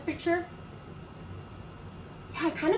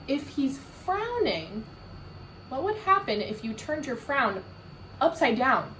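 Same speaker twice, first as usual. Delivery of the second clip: ordinary delivery, nothing marked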